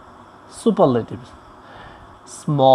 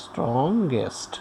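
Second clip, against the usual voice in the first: slow, lower and much softer; slow dictation; 4 semitones lower, 10 dB quieter, much slower than usual. Shown as a demonstration. Teacher repeating the superlative form for learners, slow dictation. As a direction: stretch the word out quietly, as if writing along.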